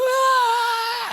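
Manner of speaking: long high wail; mock falling scream. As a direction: scream as if hurtling downhill. Imitating Doctor hurtling downhill.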